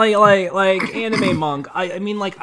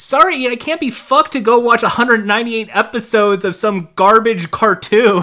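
heated rant; 5 semitones higher, 5 dB louder than usual. Real, not acted. Heated rant against watching episodes.

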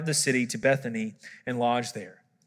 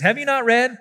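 higher-pitched, pointed rhetorical question; cutting retort; 8 semitones higher, 4 dB louder than usual. Retold or imitated. Jesus challenging the experts in the law, pointedly. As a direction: deliver it as a mild public put-down. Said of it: "reply that would twist the knife".

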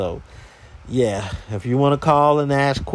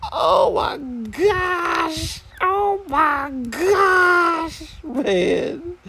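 very high, long-drawn "oh my gosh"; exasperated wail; much slower than usual. Real, not acted. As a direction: squeal it out slowly in comic despair. Exasperated at the caller's question.

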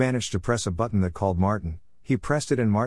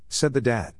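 ordinary delivery, nothing marked

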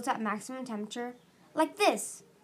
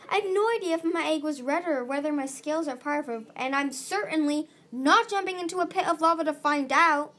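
raised, louder, emphatic protest; indignant refusal; 5 semitones higher, 6 dB louder than usual. A narrator's reading. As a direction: flatly refuse with rising force. Orchard angrily refuses.

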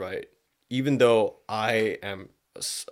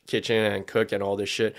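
normal talking voice

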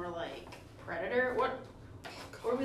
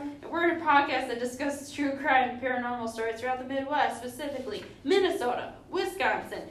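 louder, higher announcer read; podcast intro; 4 semitones higher, 6 dB louder than usual. Acted. Hosts greeting listeners, upbeat announcer read. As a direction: open the show like a host.

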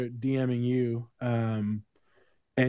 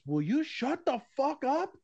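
imitating a friend's high, slow exasperation; high-pitched retold fed-up outburst; angry outburst; 12 semitones higher, slower than usual. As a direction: stretch it out as a mock-furious friend. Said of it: "I've never seen him angry".

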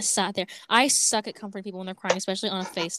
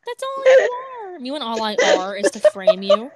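loud retort; 13 semitones higher, 5 dB louder than usual. Acted. Playful retort.

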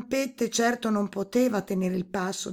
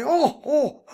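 high, loud acted toad laugh; toad bursting into laughter; 8 semitones higher, 8 dB louder than usual. A narrator's reading. Toad laughing at the princess's offer, hammed-up laughter. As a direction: burst out in a croaky mock laugh. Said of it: "il rospo scoppiò a ridere".

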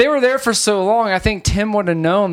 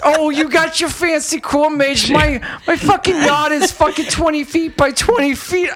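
high-pitched mock grievance; indignant homeowner; 7 semitones higher than usual. Homeowner scoffing at fancy corn maze, high indignant voice. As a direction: complain in a put-on, much higher voice.

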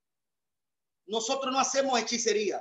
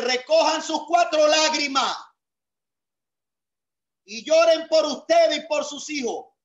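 raised, fervent preaching voice, driving each line home; preaching at full force; 4 semitones higher than usual. Acted.

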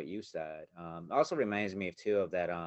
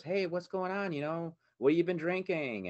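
put-on friendly old man; 10 semitones higher, 4 dB louder than usual. Old man greets the drunk.

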